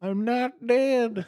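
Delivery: very high, slow squeak; squeaky old man. Retold or imitated. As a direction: squeak it out slowly in a high feeble voice. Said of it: "here's one".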